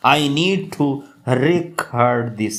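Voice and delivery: very slow teaching read with "record" stressed on the second syllable, re-CORD; verb stress on record. Shown as a demonstration. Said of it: "verb के तौर पर हम इसको use करें".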